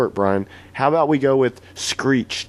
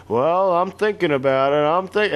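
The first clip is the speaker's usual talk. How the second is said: higher, hedging Bernie voice; Bernie Sanders; 7 semitones higher than usual. Retold or imitated. Retelling Bernie dodging the question, higher and hedging. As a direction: hedge it like a cagey candidate. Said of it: "He said".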